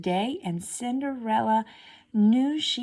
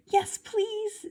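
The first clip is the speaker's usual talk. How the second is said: much higher pleading; eager stepsister. A narrator's reading. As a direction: plead sweetly and high. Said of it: "said the other stepsister".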